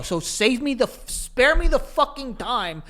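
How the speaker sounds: ordinary delivery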